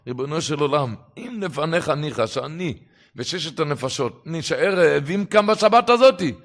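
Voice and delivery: retold plea climbing to a high, full shout; crying out to God. Storyteller re-enacting the hungry man's cry to God, shouting it out. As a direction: build to an impassioned cry, easing slightly at the end. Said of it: "אם הוא צעק".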